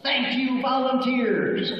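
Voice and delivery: very high, drawn-out mock cheer; high-pitched shout held short of full volume; demonstrated shout. Shown as a demonstration. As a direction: show them how to cheer it. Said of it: "you are going to shout at the top of your lungs".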